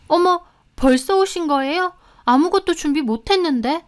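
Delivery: high-pitched startled housekeeper; flustered surprise. A narrator's reading. Housekeeper greets in-laws who came early, flustered. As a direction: react with lifted, flustered voice.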